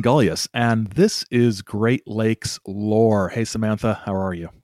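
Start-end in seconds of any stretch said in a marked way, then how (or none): none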